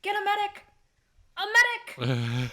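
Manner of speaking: hammed-up bad acting, loud with a halting repeat; William Shatner. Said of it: "vaguely raising his voice".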